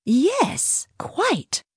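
put-on very high old-aunt voice; high, brief aunt's assent; Demetria, agreeing. A narrator's reading. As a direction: agree primly in a very high pitch. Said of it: "Demetria agreed".

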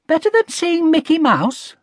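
put-on high, questioning child voice; doubtful. A narrator's reading. Connie doubting anything beats Disneyland, doubtful. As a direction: query it with lightly voiced disbelief. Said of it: "said Connie doubtfully".

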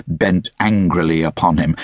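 ordinary delivery

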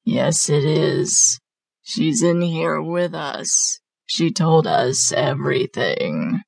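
slow, sinister possessed voice; hideous guttural voice. A narrator's reading. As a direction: draw it out as a guttural creature. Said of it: "now in a hideous guttural voice".

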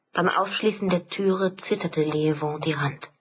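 ordinary delivery

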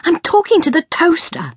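high, exasperated shriek. A narrator's reading. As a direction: shriek it in frantic self-defence. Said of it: "I shrieked".

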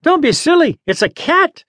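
high, excited talking-dog voice; high-pitched barking retort; dog shouting back. A narrator's reading. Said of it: "Champ shouted back".

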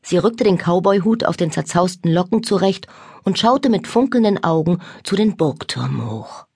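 ordinary delivery, nothing marked

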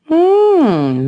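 high, loud, falling hum; pondering hum that starts high and drops; hummed hmm. A narrator's reading. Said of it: "Mel hummed".

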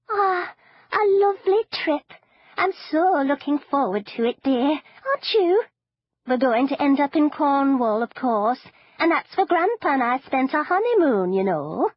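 chirpy grandmother praising her granddaughter; doting granny; old granny. Acted. Granny voiced in the story.

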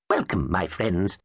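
ordinary delivery, nothing marked

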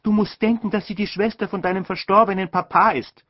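mother lecturing her son; mother's rebuke. A narrator's reading.

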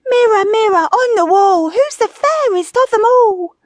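high, loud theatrical chant; grandly vain, high-pitched recital; preening wife. A narrator's reading. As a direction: declaim to the mirror in a piercing wifely voice. Wife recites to her mirror.

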